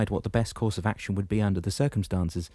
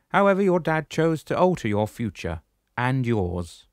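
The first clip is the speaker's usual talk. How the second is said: higher, louder commanding shepherd voice; booming old shepherd, pitched up; 6 semitones higher, 6 dB louder than usual. Acted. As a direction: address him firmly from above. Damianos speaks.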